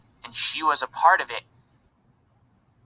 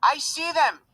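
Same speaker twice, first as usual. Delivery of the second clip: high, eager exclamation; excited; 14 semitones higher than usual. A narrator's reading. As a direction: cry out in delight. Mother spots children.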